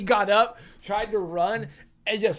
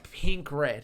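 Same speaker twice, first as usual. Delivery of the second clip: normal talking voice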